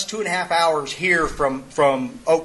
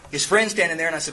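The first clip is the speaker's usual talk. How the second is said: ordinary delivery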